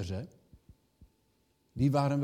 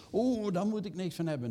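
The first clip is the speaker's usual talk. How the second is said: mocking disdain; 6 semitones higher than usual. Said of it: "en dat je dan hoort".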